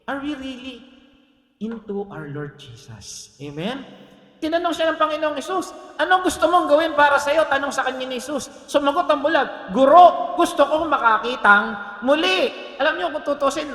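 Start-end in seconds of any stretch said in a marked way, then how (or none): 4.4-13.7 high, loud Bible retelling; ringing, raised preacher's voice; full-force preaching. Acted. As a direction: proclaim the story to the whole congregation.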